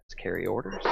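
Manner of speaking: low hushed commentator voice; quiet golf broadcast call; golf announcer. Acted. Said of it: "I feel like I should be talking like a golf announcer".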